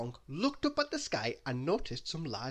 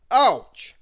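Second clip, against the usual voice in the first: high, loud yelp of pain; a sharp cry from a hammered thumb; loud ouch; 9 semitones higher, 16 dB louder than usual. Acted. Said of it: "As Mister Bump hit his thumb".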